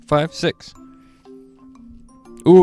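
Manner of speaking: plain reading voice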